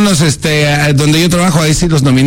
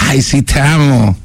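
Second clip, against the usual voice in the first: sarcastic "te amo". Retold or imitated. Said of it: "entre risas sarcásticas".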